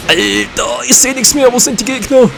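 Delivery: high-pitched gamer outcry; exasperated and worked up mid-fight; agitated complaint. Real, not acted. Player loses sight of opponents.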